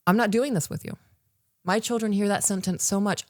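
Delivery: usual speaking voice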